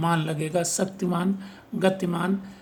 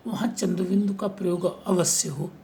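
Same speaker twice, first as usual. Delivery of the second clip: ordinary delivery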